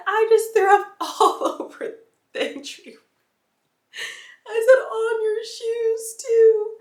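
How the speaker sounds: high, slow tearful wail; crying. Retold or imitated. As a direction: wail it through tears. Crying confession to boyfriend.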